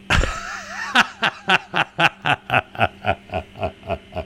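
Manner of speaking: long high giggle; real laugh. Real, not acted. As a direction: giggle on and on at your own punchline. Laughs at own joke.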